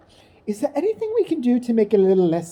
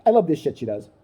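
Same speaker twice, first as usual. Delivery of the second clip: ordinary talk, nothing marked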